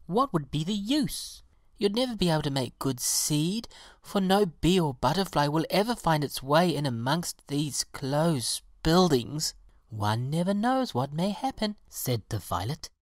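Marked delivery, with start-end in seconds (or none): 0.1-9.5 high-pitched, defeatist protest; dismissive pansy. A narrator's reading. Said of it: "cried the pansy". 9.9-11.8 lightly voiced violet, higher pitch; hopeful violet. A narrator's reading.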